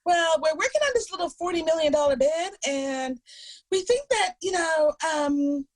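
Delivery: high, condescending boss voice; mock boss voice. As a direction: mimic the patronizing boss. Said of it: "And they're like".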